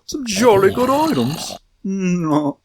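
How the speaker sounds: put-on a playful British accent on jolly good; mock British accent. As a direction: savour it like a posh British gamer. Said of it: "super British or whatever".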